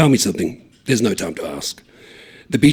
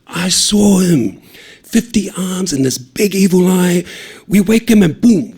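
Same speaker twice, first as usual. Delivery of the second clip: urgent; 9 semitones higher, 7 dB louder than usual. Acted. Kid tells scary sea monster tale.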